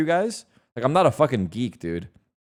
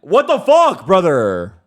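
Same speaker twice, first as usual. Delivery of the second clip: loud shocked outburst; shouting; 6 semitones higher, 8 dB louder, slower than usual. Real, not acted. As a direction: yell it in disbelief. Shocked at being called cheater.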